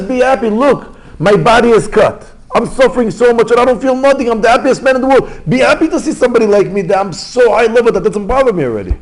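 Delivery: very high, loud proclamation of joy; impassioned insistence. Acted. Wounded rabbi telling his friend to be happy, impassioned. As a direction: preach it at full voice, elated and insistent.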